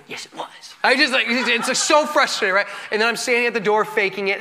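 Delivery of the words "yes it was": whispered back under the worship. Retold or imitated. The pastor insisting to his wife in church, whispered. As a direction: hiss it back low so no one hears.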